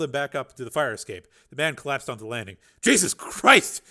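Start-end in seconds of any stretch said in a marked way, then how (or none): 2.8-3.8 slow, higher and louder breathless gasp. Acted. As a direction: gasp it out in shock after being hauled up. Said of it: "he gasped".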